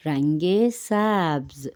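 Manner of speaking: رنگ سبز slowly, with a long open a in سبز; stretched vowel. Shown as a demonstration. Teacher repeats green for learners, slow stretched vowel. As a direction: draw out سبز as "saabz". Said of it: "زبر کو ذرا کھینچ کے".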